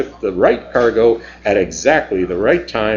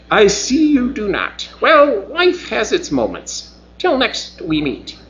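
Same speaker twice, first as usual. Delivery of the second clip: Cebus; 8 semitones higher than usual. Acted. Alien Cebus voiced higher.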